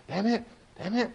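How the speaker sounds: high, softened mock cursing; imitating traffic cussing. Retelling what dad said driving in Charlotte, high soft mock cursing. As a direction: mimic the cussing in a small high voice. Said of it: "that's what her daddy did driving".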